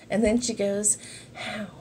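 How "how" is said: imitating a soft ASMR whisper; mock ASMR whisper greeting. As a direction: whisper each hello to the camera like an ASMR host. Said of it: "you're whispering".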